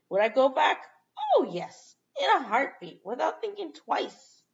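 loud, high-pitched eager burst; emphatic enthusiasm. Real, not acted. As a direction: answer yourself with gleeful certainty, voice jumping up. Eager answer to own question.

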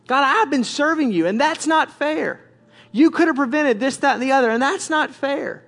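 high, aggrieved complaint; wronged servant pleading their case; indignant protest to God. Acted. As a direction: drag out the final words in protest.

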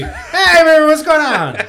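high, loud put-on voice; Bucky. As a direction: greet them in a comically high, loud voice. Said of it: "gonna sound like Bucky".

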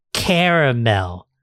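slow, stretched-out winner announcement; drawn-out reveal. Real, not acted. Host announcing the number one flavor, drawn out. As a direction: stretch the word out as the big reveal.

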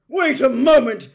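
very loud, high angry command; outraged full-volume shout; bellowing. A narrator's reading. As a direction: bellow it at the fireplace. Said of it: "he bellowed at the fire".

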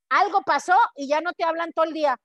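ordinary delivery

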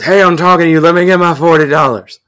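strung-out man yelling for his money; demanding shout. Retold or imitated. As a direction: bark it at the waitress like a junkie.

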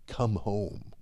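soft, gentle plea; a dad's quiet invitation home; tender call. Acted. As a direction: call them home softly. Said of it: "mouths two simple words".

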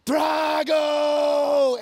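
very high, very loud drawn-out scream of "Drago"; imitating a brother's raging yell; furious yell. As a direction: yell the name like a kid gone wild. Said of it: "he would literally just yell".

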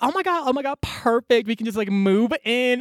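playful imitation of delighted freeloaders; excited creatures. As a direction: gush as if landing a perfect new home. Creatures thrilled at free housing.

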